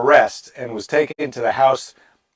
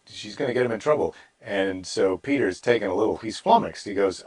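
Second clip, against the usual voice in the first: ordinary talk, nothing marked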